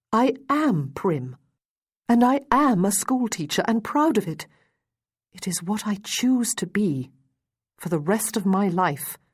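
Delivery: bristling, insisting on her pride; proud defiance. A narrator's reading. Defiant reply to his taunt.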